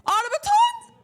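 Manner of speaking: very high, loud mocking voice; high mock question; shrill imitation. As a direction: squeak it back as a mockery. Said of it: "That's not how I fucking talk".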